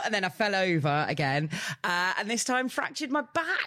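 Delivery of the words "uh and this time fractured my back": ordinary talk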